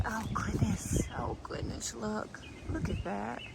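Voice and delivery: breathless hushed "oh goodness"; awed whisper. Real, not acted. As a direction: whisper it in wonder. Awed at the blossoms.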